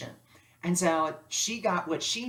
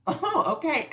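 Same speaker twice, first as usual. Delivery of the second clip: surprised; 11 semitones higher, 5 dB louder than usual. Retold or imitated. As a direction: caught off guard, stretch it. Surprised by his answer.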